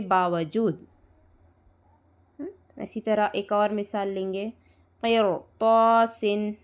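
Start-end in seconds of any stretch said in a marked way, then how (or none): 5.0-6.5 क़िरतासिन drawn out slowly with a thick R and long heavy "to"; full, heavy R. Shown as a demonstration. Teacher giving another example to students, क़िरतासिन slow with heavy R. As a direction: stretch क़िरतासिन, keeping the R and T full. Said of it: "रॉय साकिना को पुर करेंगे".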